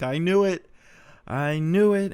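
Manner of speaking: high, loud mock triumph, imitating mom; triumphant mom. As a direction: crow it like a mother who guessed right. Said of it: "my mom loses her shit".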